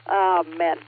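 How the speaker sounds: normal talking voice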